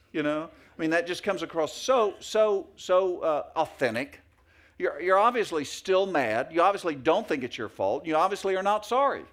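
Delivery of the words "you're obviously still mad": usual speaking voice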